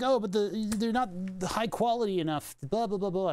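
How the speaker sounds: high, sneering put-on voice for the forum crowd; mocking whiny quote. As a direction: mimic the nitpickers with a dismissive sneer. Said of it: "they're like".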